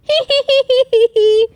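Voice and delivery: high, rapid hee-hee giggle. A narrator's reading. George giggles at Peppa's hiccups, high giggle. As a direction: giggle in a long high string. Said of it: "George giggles".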